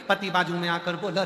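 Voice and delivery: ordinary talk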